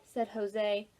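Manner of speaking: ordinary delivery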